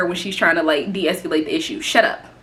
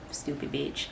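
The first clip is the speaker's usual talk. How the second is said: muttered insult; 6 semitones lower, 14 dB quieter than usual. Real, not acted.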